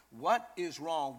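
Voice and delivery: ordinary delivery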